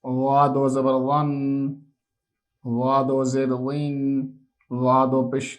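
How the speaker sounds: put-on steady sing-song letter drill, said slowly for children; measured recitation for a child to repeat after. Shown as a demonstration. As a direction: chant each syllable like a classroom takhti reading. Teacher chants the alphabet drill.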